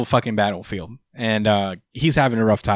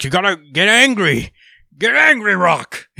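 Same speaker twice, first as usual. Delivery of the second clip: loud, high Rocky-style urging; hammed-up coach goading a fighter to rage; angry pep-talk; 7 semitones higher, 5 dB louder than usual. Elec riles up Gas like that Rocky scene, mock angry yelling. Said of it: "like, his angry speech".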